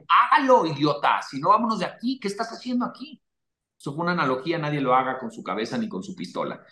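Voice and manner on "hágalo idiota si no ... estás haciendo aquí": harsh self-command. Retold or imitated. Scolding himself into action, sharp commanding bark. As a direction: snap at yourself to act. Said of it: "Yo digo".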